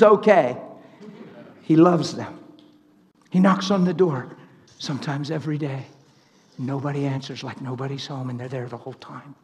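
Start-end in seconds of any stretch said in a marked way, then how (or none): none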